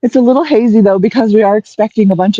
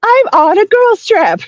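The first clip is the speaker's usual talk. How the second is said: high, slow fed-up reply; exasperated mom; 11 semitones higher, slower than usual. Retold or imitated. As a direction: draw it out, fed up. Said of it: "And she's like".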